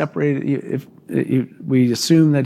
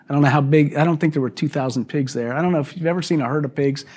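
ordinary talk, nothing marked

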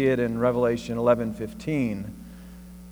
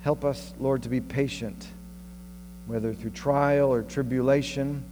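prayer; 5 dB quieter than usual. Acted. Closing prayer.

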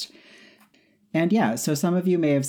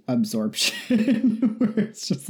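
laughing; 10 semitones higher than usual. Real, not acted. Laughs mid-word.

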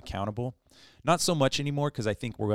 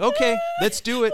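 eager; 13 semitones higher, 11 dB louder than usual. Retold or imitated. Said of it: "I'd be like".

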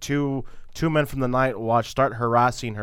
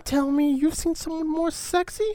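high seductive voice. Retold or imitated.

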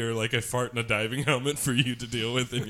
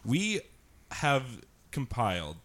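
ordinary talk

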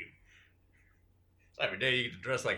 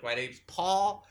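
slow, deliberate insistence; slow indignant correction; slower than usual. Acted. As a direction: spell it out slowly, offended. Actor insists on real name.

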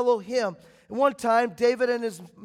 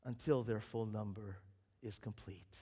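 much softer, hushed answer; voice from the throne; 12 semitones lower, 10 dB quieter than usual. Acted. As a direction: let it fade to almost nothing, calm and final. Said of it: "the voice from the throne comes back and says".

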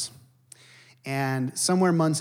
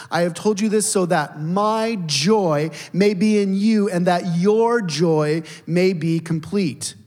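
slow, louder and higher, proclaiming; preaching Jesus' words; 4 semitones higher, 5 dB louder, slower than usual. Acted. The pastor proclaims Jesus' words to the congregation, slow and loud. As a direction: lift the promise out to the whole room.

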